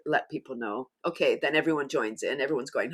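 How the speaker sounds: ordinary talk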